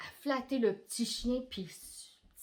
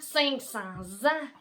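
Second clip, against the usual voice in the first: ordinary delivery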